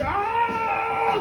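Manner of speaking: high, wailing dog cry; sick dog howl. Acted. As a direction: wail like a suffering dog. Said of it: "dragging his arse on the ground".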